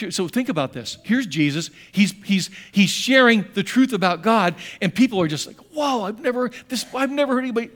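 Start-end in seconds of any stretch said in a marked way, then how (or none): 5.7-7.7 higher, awed gasp of wonder; astonished. Retold or imitated. The astonished crowd in the synagogue, hearing Jesus teach, amazed. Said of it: "they're astonished".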